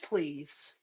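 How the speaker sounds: ordinary talk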